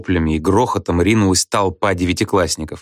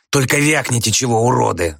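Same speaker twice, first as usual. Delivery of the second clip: lightly voiced hoarse threat; a touch of rasp, warning off; hoarse yell; 3 semitones higher than usual. A narrator's reading. Red-haired boy threatens his classmates, hoarse yell. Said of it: "заорал рыжий и носатый с хриплым голосом".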